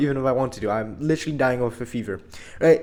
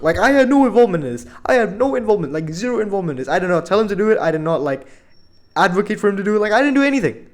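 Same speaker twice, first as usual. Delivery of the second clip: indignant protest; 6 semitones higher, 4 dB louder, faster than usual. Real, not acted. Protesting innocence to viewers.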